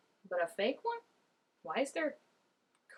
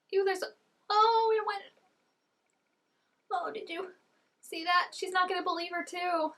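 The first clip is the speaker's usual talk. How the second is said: high, loud disgusted reaction; grossed-out exclamation; 10 semitones higher, 5 dB louder than usual. Real, not acted. Watching teeth being washed, high loud disgust. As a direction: squeal at the gross sight. Grossed out by what she sees.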